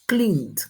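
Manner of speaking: "Cleaned" as one syllable with a final D after the N; past-tense pronunciation. Shown as a demonstration. Teacher shows students the past of clean, ending sounded clearly. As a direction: close "Cleaned" on a released stop. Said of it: "entonces es en D el pasado".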